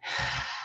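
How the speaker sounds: soft, voiceless exhale; calming breath out. Shown as a demonstration. Coach showing how to keep breathing, soft sigh. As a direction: let a quiet sigh go. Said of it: "keep breathing".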